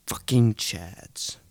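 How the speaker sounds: normal talking voice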